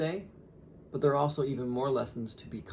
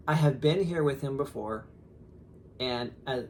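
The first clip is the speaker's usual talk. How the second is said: ordinary talk, nothing marked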